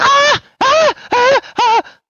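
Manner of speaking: high piercing terrified screaming; frantic screams. Acted. Madwoman screaming at the party. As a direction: shriek over and over in terror. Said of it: "And she's like".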